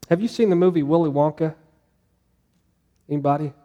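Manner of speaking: normal talking voice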